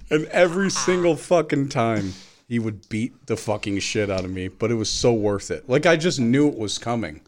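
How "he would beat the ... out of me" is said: normal talking voice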